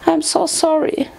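high-pitched, shuddering apology; Fluttershy, stammering and shuddering. A narrator's reading. Tearful friend apologising to Twilight, shuddering. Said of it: "she stammered, shuddering".

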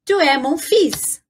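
ordinary talk

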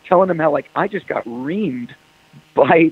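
normal talking voice

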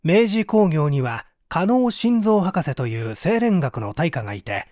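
ordinary talk, nothing marked

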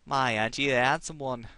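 flirty Cockney tavern wench serving ale; a barmaid in a Cockney accent, flirting with a customer. Acted. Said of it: "Give her a nice little Cockney accent".

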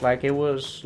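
ordinary delivery

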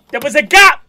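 loud, high exclamation; outraged shout. Real, not acted. Reactor spots a gap on the shelf, outraged shout. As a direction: call out the fault at full volume.

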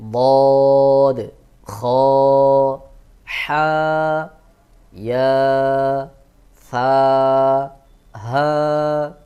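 হা pressed from mid-throat, drawn out; throat letters pressed out. Shown as a demonstration. Teacher drilling throat letters, loud and drawn out. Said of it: "হলকের মধ্যখান থেকে একটু চাপ দিয়ে উচ্চারণ করতে হবে".

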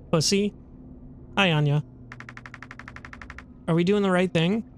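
normal talking voice

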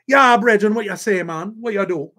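put-on high, bouncy Jamaican accent; imitated Jamaican accent, pitched high. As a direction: greet him like a Jamaican rude boy. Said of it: "with the reggae Jamaican accent".

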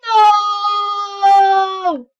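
loud high cry of no; despairing shout. Real, not acted. Gamer crying out as his character dies. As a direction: wail it out as you lose. Dying in game.

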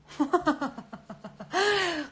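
high bursting laugh; loud laugh. Real, not acted. Wild laugh at chaotic live. (크게 터지는 웃음)

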